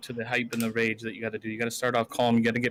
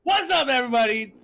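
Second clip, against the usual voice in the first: high booming streamer hello, much louder; commanding voice; 12 semitones higher, 7 dB louder than usual. Real, not acted. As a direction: boom it like opening a stream. Opens his self-introduction.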